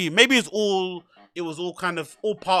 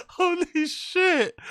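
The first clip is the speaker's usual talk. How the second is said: laughing disbelief; 11 semitones higher, much slower than usual. Real, not acted. Amazed at tiny pizza.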